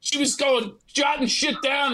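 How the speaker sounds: ordinary talk, nothing marked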